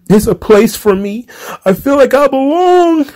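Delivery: a high, whiny mock of an earnest wrestler. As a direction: parrot his feelings back in a needy voice. Mocking the wrestler's speech.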